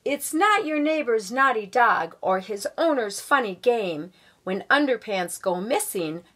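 put-on lively read-aloud voice for children; storybook reading. A narrator's reading. Guest reader reading a picture book to schoolchildren, lively storybook voice.

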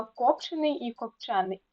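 КОПчений, then копЧЕний; contrasting stress. Shown as a demonstration. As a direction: show копчений with first, then second syllable stressed. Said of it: "значення слова залежить від наголосу".

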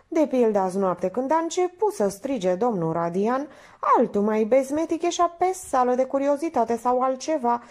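high-pitched, chattering woman's voice; naive chatterbox Minerva. Acted. Minerva explaining the night's danger, chattering.

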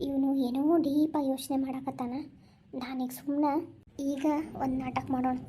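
low, quiet plotting to herself; a schemer gloating under her breath; scheming aside. Acted.

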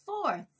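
"fourth" carefully ending in th with the tongue; tongue-made final th. Shown as a demonstration. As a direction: model "fourth", releasing a clear final th. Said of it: "この最後のthは必ずベロを使って". (最後のthに舌を使って「fourth」と発音)

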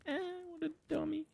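a lightly squeaky mocking voice. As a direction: tease it in a tiny silly voice. Mocking the rabbit.

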